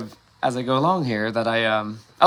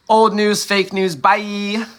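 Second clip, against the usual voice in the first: high, held singsong brush-off; loud, high, playful send-off; mock dismissal; 7 semitones higher, 4 dB louder, much slower than usual. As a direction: chant it away in a raised, drawn-out voice. Mock dismissal of old news.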